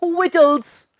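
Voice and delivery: विटल्स, high-pitched VIT-ulz; correct pronunciation. Shown as a demonstration. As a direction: model विटल्स with a silent C. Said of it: "इसका सही उच्चारण यही है".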